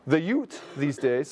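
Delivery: put-on slow German Canadian farm accent. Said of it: "my, um, German Canadian farm accent".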